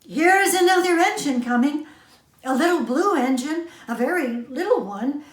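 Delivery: high, loud and fast, excited calling; eager call. Acted. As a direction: shout the good news to everyone. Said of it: "the little clown called out".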